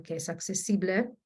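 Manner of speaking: normal talking voice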